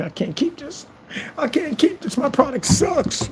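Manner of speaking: high, soft whiny mockery; mock whimper. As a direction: whimper it like a defeated loser. Mocking troll returning money.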